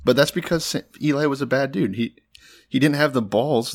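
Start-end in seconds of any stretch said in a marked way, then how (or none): none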